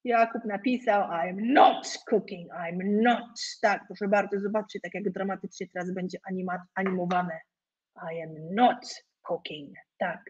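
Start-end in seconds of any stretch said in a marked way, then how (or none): 1.0-3.4 slow, with "not" hit hard and stretched; stress on NOT. Shown as a demonstration. Pokaz przeczenia z naciskiem na not. 8.1-9.8 slow, low, "not" drawn out; stress on NOT. Shown as a demonstration. Powtórka przeczenia, wolno, z naciskiem.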